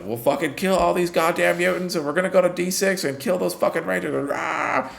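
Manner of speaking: high, fast mock rant of a fascist speech; mock rally rant, pitched high; ranting Nazi leader. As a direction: ham up a frothing rally speaker. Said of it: "the guy's just yelling".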